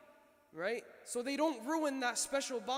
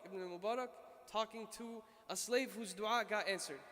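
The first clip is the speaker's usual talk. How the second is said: ordinary talk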